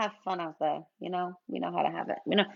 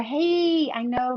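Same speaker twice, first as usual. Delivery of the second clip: ordinary talk, nothing marked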